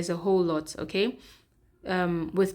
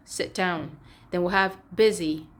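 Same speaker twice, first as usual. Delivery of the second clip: normal talking voice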